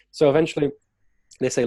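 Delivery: usual speaking voice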